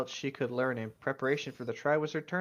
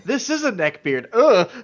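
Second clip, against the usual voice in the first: disgusted groan; 7 semitones higher, 11 dB louder than usual. Real, not acted. Disgust at cringe fanfic line.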